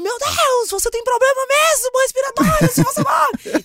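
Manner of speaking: high, loud furious scolding; angry friends. Retold or imitated. Friends berating her after the fake faint, high furious scolding. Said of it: "com raiva".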